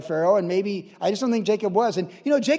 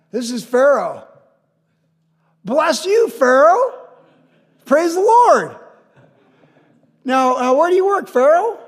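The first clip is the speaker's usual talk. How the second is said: very high, very loud hammed-up hollering; shouted elderly exchange; 9 semitones higher, 10 dB louder, slower than usual. Old residents being introduced in assisted living, comic shouting. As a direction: holler as if to someone hard of hearing.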